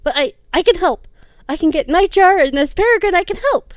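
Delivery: high, loud breathless pleading with mother; desperate pleading. A narrator's reading. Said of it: "he gasped out".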